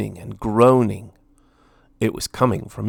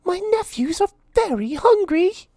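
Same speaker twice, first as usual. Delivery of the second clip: Minnie pleading. A narrator's reading. Minnie begging Lord Gurr, high pleading mouse voice. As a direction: beg sweetly for food.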